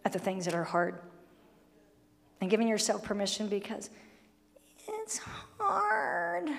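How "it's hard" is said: hammed-up crybaby whine; mock crying. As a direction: fake sob on it's hard, much higher. Mock crying about fasting.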